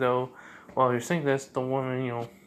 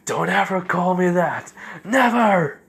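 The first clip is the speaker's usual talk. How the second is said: loud, high-pitched angry shout; explode, hitting the last word hardest; furious outburst; 7 semitones higher, 9 dB louder than usual. Acted. Zabuza to the woman using his nickname, furious shout.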